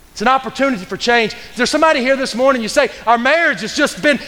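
high, urgent pulpit appeal; full-force preaching. Real, not acted. Preacher to those with struggling marriages, urgent high-pitched preaching. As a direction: press the appeal home with fervour.

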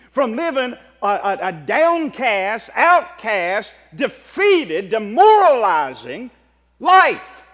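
loud, drawn-out preacher's proclamation; full-force preaching. Real, not acted. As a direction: preach it loud, stretching the key words.

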